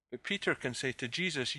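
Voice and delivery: ordinary delivery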